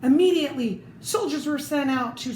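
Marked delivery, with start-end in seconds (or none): none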